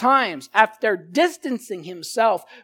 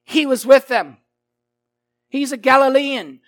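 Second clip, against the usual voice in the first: louder, higher declaration; bold assertion; 6 semitones higher, 9 dB louder than usual. Acted. Bystander loudly declaring Peter is a Galilean. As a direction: proclaim it boldly. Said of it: "confident assertion with emphasis".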